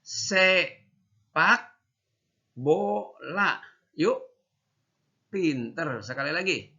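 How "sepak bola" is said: "Sepak" high and rising, "bola" after a pause; spaced out for kids to echo; model for repeating. Shown as a demonstration. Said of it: "Ini, yuk".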